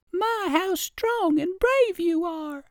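very high, slow tiny-ant squeak; little ant voice. Acted. Little red ant praising the rabbit, very high squeaky voice.

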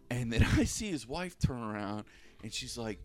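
normal talking voice

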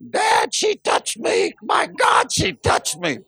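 put-on high, slow, whining child's complaint; whiny kid. Child in the van whining to Dad, high and drawn out. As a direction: tattle to Dad like a bratty kid.

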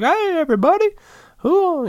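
very high, hammed-up cheery old-man voice; jolly old guy. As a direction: greet the room as a merry old geezer, way up high. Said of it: "the jolly old guy who's like".

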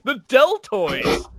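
high, stretched-out commentator exclamation; Vince McMahon impression. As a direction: draw it out high, like an awed announcer. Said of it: "and Vince says".

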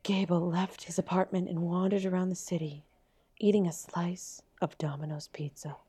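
soft, hushed dramatic reading; whispering. Pretend. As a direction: whisper it like a sad news story. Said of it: "we need some sad music".

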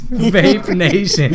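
slow, cracking up while repeating; laughing. Real, not acted. Laughing repeat of kid's line.

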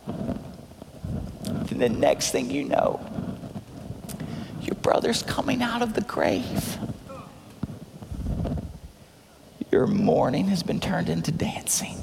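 near-whispered, suspenseful storytelling; soft, wondering hush; hushed awe. Real, not acted. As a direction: drop to a breath for the miracle. Hushed suspense before the miracle.

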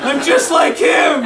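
high, slow mock wail; hammed-up anguished shout. A narrator's reading. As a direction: cry it out in despair. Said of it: "he starts weeping".

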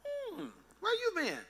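high, slow, soft sneering question; drawn-out high mocking voice; mock suspicious high voice. Old friends sneering at you in a high slow voice. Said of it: "They're like".

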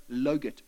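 low "Logurt", L in place of Y; child's mispronunciation. Retold or imitated. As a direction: answer "Logurt" with an L at the front. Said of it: "how do you say it?".

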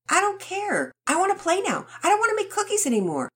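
high, fast insistent whine; stubborn child. Acted. Rocket refuses to keep making cookies, stubborn whine. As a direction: dig in like a stubborn kid. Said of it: "Rocket persisted".

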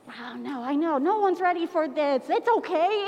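ordinary talk

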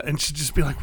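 ordinary delivery

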